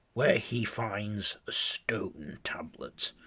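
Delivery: plain reading voice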